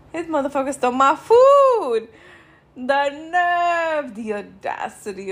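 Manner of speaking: outraged. Real, not acted. Outraged at stolen food.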